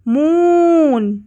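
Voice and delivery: मून with the oo held long and rising high; long stretched oo. Shown as a demonstration. Teacher showing pupils the long sound in मून. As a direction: draw out the oo in मून. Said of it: "मून में लंबा ओ का sound है".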